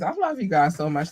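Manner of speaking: ordinary delivery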